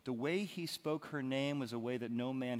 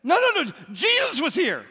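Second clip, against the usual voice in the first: very high, loud, fast breathless news; excited disciples; 17 dB louder than usual. Acted. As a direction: blurt it out, bursting to tell. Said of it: "they're like".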